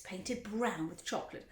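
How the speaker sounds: plain reading voice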